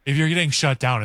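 normal talking voice

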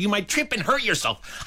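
high-pitched fussy warning; prim, cautioning girl. Retold or imitated. Emily to boy in the hall, prim warning. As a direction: imitate a child lecturing like a grown-up. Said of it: "you sound like you're forty-five years old".

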